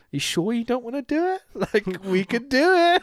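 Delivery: high, teasing lilt; teasing Naito. Retold or imitated. Naito teasing Ibushi about a title fight, playful lilt. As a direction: tease him into it. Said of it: "Naito coming out ... going like".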